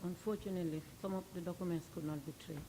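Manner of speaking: speaking too low, far from the mic; soft voice that barely carries; barely audible. Real, not acted. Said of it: "your voice is very low".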